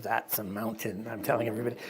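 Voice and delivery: a hint of a dry ironic Scotsman; dry Scots Presbyterian. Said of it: "Scots Presbyterian came to the place and said".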